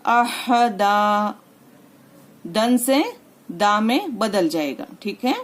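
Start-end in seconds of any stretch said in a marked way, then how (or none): 0.1-1.4 अह दा drawn out slowly, ending on a long दा with no न; paused form. Shown as a demonstration. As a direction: stretch अह दा, dropping the nunation. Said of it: "हम इस तरीके से कहेंगे".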